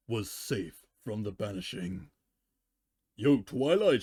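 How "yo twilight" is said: calling out down a hall, louder; a young dragon hollering a hello; light shout across the castle; shouted greeting. A narrator's reading. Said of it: "shouted Spike".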